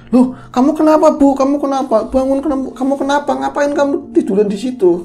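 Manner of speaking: high-pitched, startled string of questions; alarmed questioning. Retold or imitated. Husband finding his wife collapsed on the floor, high alarmed voice. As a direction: act out a husband's sudden alarm.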